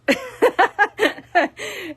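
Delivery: high, loud burst of laughter; laughing with her viewers. Real, not acted. Laughs while asking viewers.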